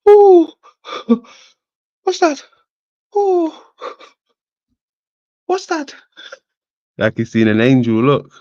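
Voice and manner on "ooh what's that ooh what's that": much higher, squealing ooh; mock a startled little voice; squeaky falsetto. Mocking the sleeping creature.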